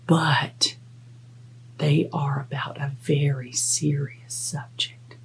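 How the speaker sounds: mock-serious hushed whisper. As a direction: whisper it like a big secret. Building suspense for children.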